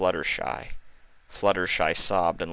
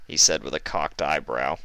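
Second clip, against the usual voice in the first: ordinary delivery, nothing marked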